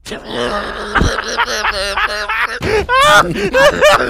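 long, high burst of laughter; laughing. Real, not acted. Cohost reacting to the Dracula punchline, laughing hard. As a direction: crack up helplessly, high-pitched.

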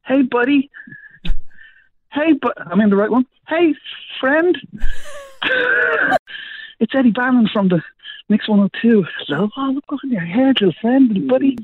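high, sing-song wake-up voice; cooing at someone asleep; gentle coaxing. Real, not acted. Gently waking a sleeping stranger.

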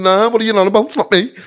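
high, loud mock innocence; hammed-up indignant brush-off; retold denial. Retold denial to jeering inmates.

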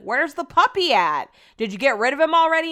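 loud, high neighbor impression; mock nosy neighbor. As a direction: call out nosy questions as a drunk neighbor. Imitates drunk neighbor.

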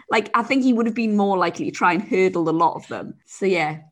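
normal talking voice

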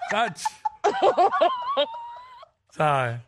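laughing. Real, not acted. Laughing through the joke.

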